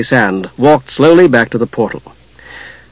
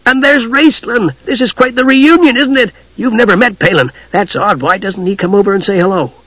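high, eager, rambling little adventurer; chattering kender; 7 semitones higher than usual. Acted. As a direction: prattle on excitedly in a higher voice.

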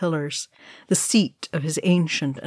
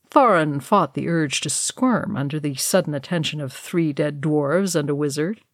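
ordinary delivery

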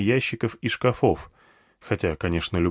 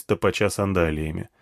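ordinary delivery

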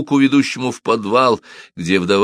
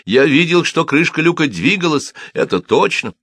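loud, high insistence; shouting; 8 semitones higher, 4 dB louder than usual. A narrator's reading. Cobbler convincing guards of what he saw, shouting. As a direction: insist at the top of the voice. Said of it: "кричал Симон".

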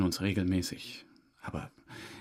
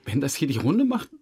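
higher and louder in alarm; panicked pleading; 8 semitones higher, 4 dB louder than usual. Acted. Suspect panicking to the detective about his old mother, alarmed. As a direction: blurt it out in fear.

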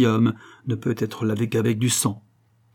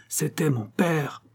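protesting cry; 6 semitones higher, slower than usual. A narrator's reading. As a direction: draw out the protest. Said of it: "s'écria Don Ramon". (cri de protestation)

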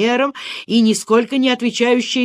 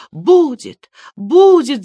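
higher, louder frantic cry; yelped surrender; 6 semitones higher, 7 dB louder, slower than usual. A narrator's reading. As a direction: wail it as if giving in. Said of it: "завопил он". (вопль сдающегося)

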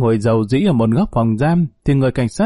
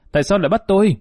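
higher-pitched protest, no real shout; mild narrated cry; 7 semitones higher, much faster than usual. A narrator's reading. Bà Chót protests being handcuffed by police, mild outcry. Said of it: "Bà Chót la lên".

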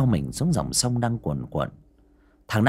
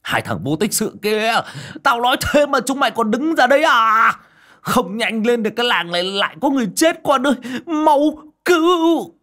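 much higher, much louder furious bark; angry scolding yell; 7 dB louder than usual. Acted. Old man scolding useless boys while running, furious yell. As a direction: berate the idle boys, then scream for help. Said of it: "miệng vẫn không ngừng quát".

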